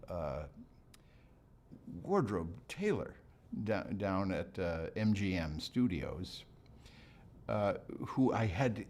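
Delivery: ordinary talk, nothing marked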